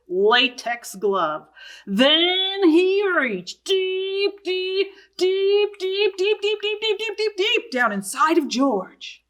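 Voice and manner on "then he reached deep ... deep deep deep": storyteller milking the suspense, pitched up; suspense build. Acted. Vet reaches deep inside George.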